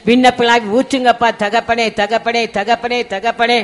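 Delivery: earnest pleading prayer, pressing and urgent; prayer voice, rising to a plea and fading to a hush; fervent prayer. Real, not acted. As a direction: pour it out to God, then let it settle humbly. Leads fervent group prayer.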